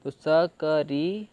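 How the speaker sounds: स क री said sound by sound, slowly; teacher slowly blending स क री. Shown as a demonstration. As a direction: break स क री apart slowly. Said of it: "ई का साउंड हमारा र में जुड़ गया".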